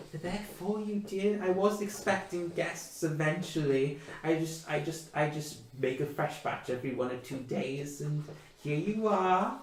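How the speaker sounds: put-on high, kindly old mother's voice; a hint of a chatty elderly mother; old woman. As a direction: fuss over the guests like a doting granny. Old woman offers tray bake.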